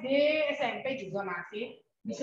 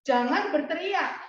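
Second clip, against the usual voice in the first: teacher calls out the rule to the class, high-pitched; high, carrying classroom voice; raised teaching voice; 5 semitones higher than usual. Real, not acted. Teacher translating for the class, raised high voice. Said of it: "Jangan teriak, Bu Meti".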